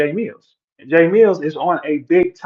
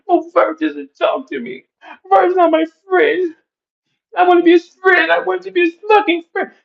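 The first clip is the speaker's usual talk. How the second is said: mocking whiny voice; 14 semitones higher than usual. Mocking people who whine that Verb ignores them, high and whiny. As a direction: whine like a hurt fanboy.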